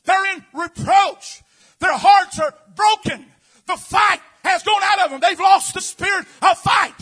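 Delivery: loud, building preacher's cry; full-force preaching. Acted. Preacher crying out to the congregation, full force. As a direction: drive it home to the church.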